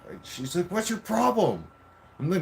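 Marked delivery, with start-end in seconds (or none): 0.7-1.7 imitating her high-pitched aggressive challenge; aggressive retort. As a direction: throw it back high and combative. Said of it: "that's when she got aggressive".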